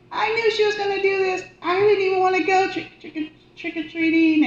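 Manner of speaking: high, loud grieving plea; anguished cry. Retold or imitated. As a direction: wail at her dead mother. Yelling while running around.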